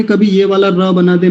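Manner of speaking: normal talking voice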